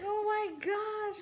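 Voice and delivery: high, loud shocked shriek; panicked shriek. Retold or imitated. Retold panic over collapsed girl.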